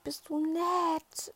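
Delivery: slow, breathless whisper of amazement; awed whisper. Retold or imitated. Riding girl whispering her amazement to the teacher. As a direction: hush the words as if stunned by kindness.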